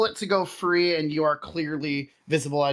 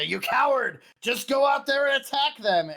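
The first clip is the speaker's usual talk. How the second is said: shouting goblin, pitched up; angry hobgoblin yell; 9 semitones higher than usual. Acted. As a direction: yell at the coward like a goblin boss. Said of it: "another hobgoblin's voice come there, say".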